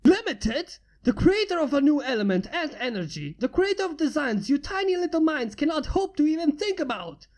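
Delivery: high, loud offended rant; indignant outburst. Acted. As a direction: boast furiously at the fools. Human outraged at insult.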